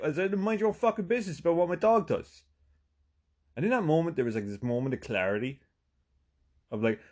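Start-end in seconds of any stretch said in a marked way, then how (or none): 0.4-2.4 high, heated retold comeback; indignant retort. Dog walker telling off nosy neighbor, indignant retort. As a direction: fire back indignantly, pitched up.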